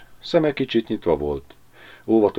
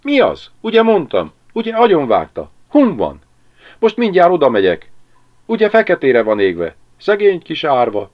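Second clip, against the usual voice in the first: high, loud, agitated chatter; excited questioning; 9 semitones higher, 7 dB louder than usual. A narrator's reading. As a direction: pelt them with shrill-pitched questions. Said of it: "A levegőben izgalom terjengett". (izgatottan, magas hangon kérdezősködve)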